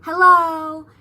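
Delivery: loud, curt mock hello from the bank clerk; rude hello. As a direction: snap a rude greeting. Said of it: "she was like".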